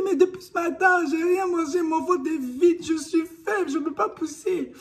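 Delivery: high, loud wailing plea, slowing; desperate plea. Retold or imitated. Mother in labor to midwife, wailing she's too weak. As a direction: cry out weakly that you're empty.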